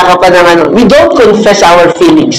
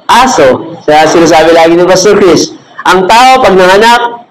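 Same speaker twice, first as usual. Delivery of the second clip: usual speaking voice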